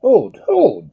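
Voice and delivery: high, loud, desperate shout; frantic cry. Acted. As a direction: stop him with a frantic cry. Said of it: "cried Edmund".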